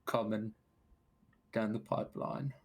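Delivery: rambling Cockney man of science; Cockney accent. Acted. Biologist introducing himself to the party, Cockney accent. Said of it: "your Cockney is better than my Southern".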